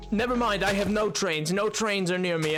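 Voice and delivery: ordinary delivery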